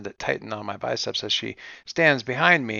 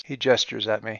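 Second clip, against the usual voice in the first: usual speaking voice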